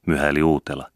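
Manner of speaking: usual speaking voice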